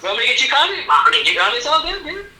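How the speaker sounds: high, eager yes-man lightly voiced; eager sidekick voice. Mocking Don Cheadle as always happy, in a high eager voice. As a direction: play him like an overeager helper.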